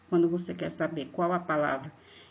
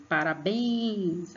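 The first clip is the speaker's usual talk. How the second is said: "Parabéns" drawn out as a call; calling-out syllable stress; much slower than usual. Shown as a demonstration. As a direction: lean long on the "béns" of "Parabéns". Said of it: "como se você estivesse chamando alguém".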